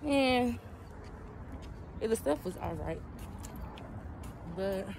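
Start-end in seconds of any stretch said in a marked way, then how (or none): none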